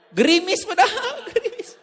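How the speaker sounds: high laughing retort. Real, not acted. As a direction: laugh it off in disbelief. Laughing at exaggeration. (tertawa tinggi tak percaya)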